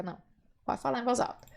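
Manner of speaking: normal talking voice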